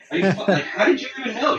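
loud, high-pitched retold disbelief; astonished outburst, played for laughs; mock incredulous. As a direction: act out being stunned, laughing at the end. Retells his astonished reply to teacher.

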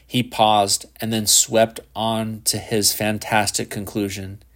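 ordinary delivery

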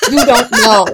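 loud playful you don't know; teasing exclamation. Acted. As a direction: call it out with a mock-shocked grin. Teasing punchline.

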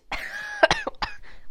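embarrassed burst of laughter; laughs. Real, not acted. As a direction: laugh at your own voice crack. Laughs at voice crack.

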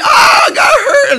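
put-on high, loud crying child wail; crying kid. Father mimicking a hurt child, loud high wail.

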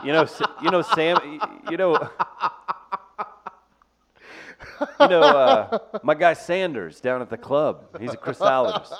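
laughing. Real, not acted. Laughing while telling a bit.

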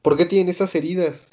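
alarmed cry over their injuries; worried crew. Retold or imitated. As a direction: sound shaken seeing the wounded friends.

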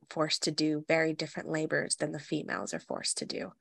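ordinary delivery

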